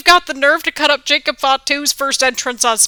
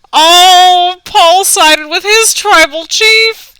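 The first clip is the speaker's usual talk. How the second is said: emotional; 6 semitones higher, 4 dB louder, much slower than usual. Real, not acted. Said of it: "I'm getting a little emotional".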